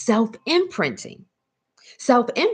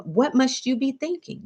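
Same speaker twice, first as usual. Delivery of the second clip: usual speaking voice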